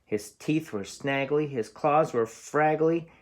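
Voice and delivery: ordinary delivery, nothing marked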